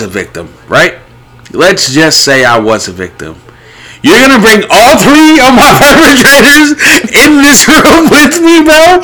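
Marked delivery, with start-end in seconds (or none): 4.0-9.0 high, loud laughing outrage; laughing disbelief. Real, not acted. Incredulous laughing rant.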